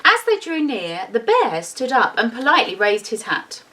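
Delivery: ordinary delivery, nothing marked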